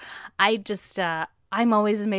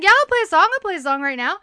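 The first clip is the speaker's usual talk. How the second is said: loud, high, eager yes; excited captain; 9 semitones higher, 9 dB louder, faster than usual. Retold or imitated. The captain answering the crowd, loud and eager. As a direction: burst out with delight. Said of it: "He's like".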